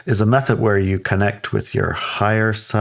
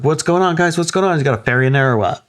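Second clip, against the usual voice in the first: higher, playful call to the dogs; retold teasing banter, pitched up; joking with dogs; 7 semitones higher, faster than usual. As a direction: tease the dogs in a raised voice. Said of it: "kinda joking with his dogs".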